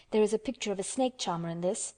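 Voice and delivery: ordinary delivery, nothing marked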